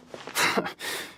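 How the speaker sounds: a short, breathless laugh of contempt; scornful laugh. Acted. Plotter scoffing at the Medal of Peace, scornful laugh. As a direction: scoff at the idea under your breath.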